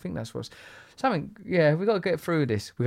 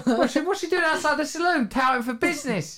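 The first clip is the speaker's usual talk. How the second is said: much higher, laughing indignation; playful high-pitched protest; giddy mock outrage; 10 semitones higher than usual. Real, not acted. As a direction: squeal it out, half laughing.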